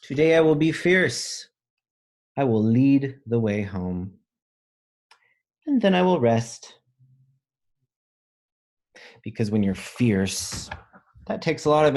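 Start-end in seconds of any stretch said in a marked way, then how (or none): 0.0-6.8 high, loud proud reading; bold storybook read. A narrator's reading. Teacher reading a picture book aloud, high and loud. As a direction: read it like a brave vow.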